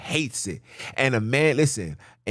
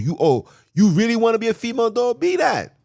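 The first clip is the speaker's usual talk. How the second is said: rising indignant outburst; 11 semitones higher, 6 dB louder, faster than usual. Real, not acted. Preacher mocking women who call themselves dogs, incredulous rising outburst. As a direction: pitch climbing much higher, louder, faster.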